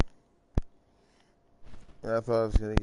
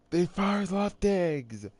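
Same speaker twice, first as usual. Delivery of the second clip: higher, slow announcer call of the pass; excited call; 9 semitones higher, slower than usual. Pretend. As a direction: call the throw like a live broadcaster. Calling a throw on replay.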